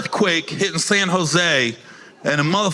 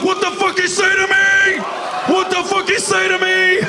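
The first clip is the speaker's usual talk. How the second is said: angry shout; 12 semitones higher than usual. Retold or imitated. A sailor yelling at the comic in outrage. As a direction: yell it in disbelief, way up high.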